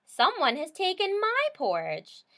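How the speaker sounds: high, loud mama bear; indignant mother bear voice; mama bear complaining; medium-sized bear voice. A narrator's reading. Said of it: "in her medium-sized voice".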